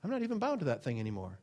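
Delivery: high "oh, yeah" sliding into a quick, soft aside; mild surprised realization. Retold or imitated. Believer noticing freedom, light surprised aside. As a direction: casually realize you're free. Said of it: "you forget".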